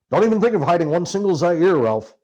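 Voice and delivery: loud, urgent warning voiced as Hunter. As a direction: warn him off hard, stressing think. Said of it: "warned Hunter".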